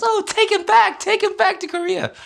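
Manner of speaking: put-on very high, loud, squealing child voice; shrill high-pitched mock child wail; mock whiny kid. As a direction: squeal it like a startled little kid begging. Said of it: "He goes".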